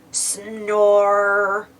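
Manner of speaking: low drawn-out snore; a sleepy pig snoring. A narrator's reading. Piggies asleep.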